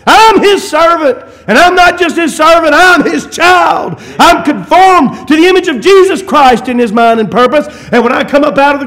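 loud, high fervent preaching; a preacher crying out to the congregation; preaching at full force. Real, not acted. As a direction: lift it up and proclaim it.

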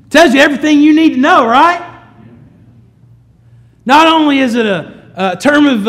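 loud, high, driving preacher's voice; full-force preaching. Real, not acted. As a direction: preach it out to the whole congregation.

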